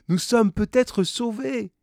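high, excited exclamation; rally the others with sudden hope; hopeful cry. A narrator's reading. Rallies crew with hope of rescue.

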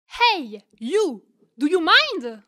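loud, sharp call to get her attention; a cleaner confronting a crewmate over the mess, calling out indignantly; indignant shout. Acted. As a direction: bark it at her with irritation. Crewmate snaps at another.

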